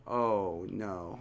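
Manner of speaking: low, hushed sinking feeling; retold dread. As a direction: let it drop softly, as if doomed. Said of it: "I was scared as hell".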